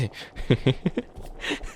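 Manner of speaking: breathy airy chuckle; laugh demo. Shown as a demonstration. Witch flustered over which laugh is hers, chuckling. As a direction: laugh it off, half-embarrassed. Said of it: "that's not my laugh".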